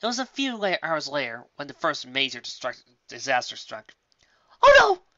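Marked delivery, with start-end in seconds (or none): 4.6-5.0 very high, loud panicked cry; loud high shriek of dismay; alarmed yell. Acted. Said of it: "Rarity yelled".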